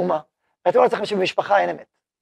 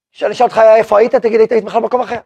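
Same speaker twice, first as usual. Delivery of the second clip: fast, slightly louder scolding; rapid rebuke; 3 semitones higher, 6 dB louder, much faster than usual. Acted. Wife confronting her lying husband, speaking fast. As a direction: rush the accusation out.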